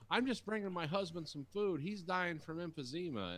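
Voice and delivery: high-pitched imitated plea; mock pleading excuse. Driver excusing herself over sick husband, high mock pleading.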